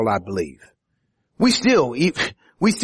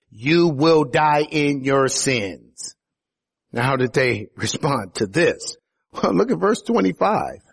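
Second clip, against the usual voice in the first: usual speaking voice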